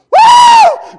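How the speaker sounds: high, loud joyful whoop; ecstatic woo. Real, not acted. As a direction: let out a shout of praise. Preacher's ecstatic cry.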